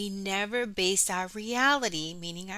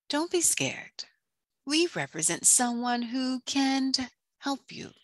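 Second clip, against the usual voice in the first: sly eels reassuring; 5 semitones higher than usual. Acted. Two eels offering Ariel help, higher coaxing voice. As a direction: coax the listener with pauses between phrases.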